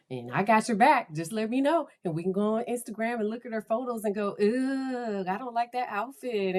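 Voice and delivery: put-on teen chatter with a disgusted "Ugh"; teenage girl. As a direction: play the girlfriend who has your back. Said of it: "channel my, my teenage girl".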